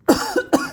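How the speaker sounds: sharp dry cough; dust coughing. Real, not acted. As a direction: clear dust from the throat. Dust in the shop.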